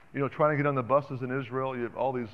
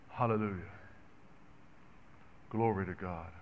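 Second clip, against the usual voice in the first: quiet worship; 5 semitones lower, 13 dB quieter than usual. Real, not acted. Preacher to God and the church, hushed reverent praise. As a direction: lower and softer, reverent murmur.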